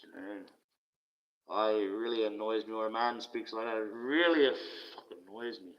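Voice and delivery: ordinary talk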